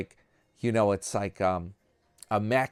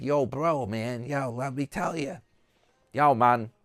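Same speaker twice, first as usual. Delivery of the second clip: put-on laid-back Puerto Rican street accent; cool surfer bro with a Puerto Rican accent; pretending to be a Puerto Rican motorcycle guy, accent on; 3 semitones higher than usual. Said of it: "I gotta do my accent".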